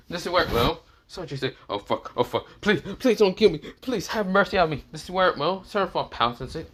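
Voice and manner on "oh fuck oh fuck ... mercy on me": high, begging for mercy; high, frantic comic begging; mock terror. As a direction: plead for your life, hammed up. Sanji realizes she's a demon lord.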